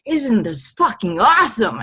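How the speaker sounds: loud, pumped-up exclamation; super pumped. Retold or imitated. Healer showing her pumped-up side, excited shout. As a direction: hype it up, fired up and loud. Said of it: "I'm super pumped".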